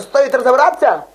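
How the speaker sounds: normal talking voice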